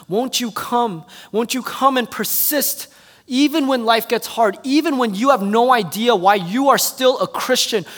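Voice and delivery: pleading refrain building to a loud, high peak; rising preacher's exhortation; impassioned preaching appeal. Real, not acted. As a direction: call the church to come, pressing harder each time.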